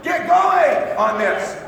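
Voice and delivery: shouted slowly and high, almost stretched out; urgent shout. Real, not acted. Speaker urging his team to get moving, a slow, high, loud shout. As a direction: drive the room into action with a drawn-out yell. Speaker exhorting the audience to act.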